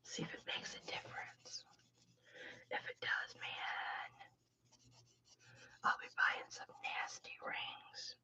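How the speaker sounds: hushed, breathy whisper with no voice. Real, not acted. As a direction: whisper it to yourself while you work. Muttered aside while testing.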